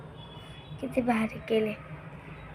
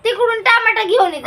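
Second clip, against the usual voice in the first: bossy order; 8 semitones higher than usual. Real, not acted. Sister orders brother.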